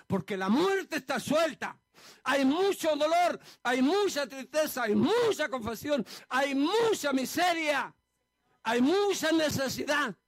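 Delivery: preaching at full force, slow and high; slow, high, loud preacher's wail. Real, not acted. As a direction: cry it out like a pulpit lament, mucha stretched. Preacher cries out rising lament.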